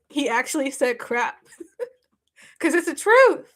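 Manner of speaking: laughing through the words. Real, not acted. To the viewers, laughing at what the officer said. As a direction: giggle while retelling it.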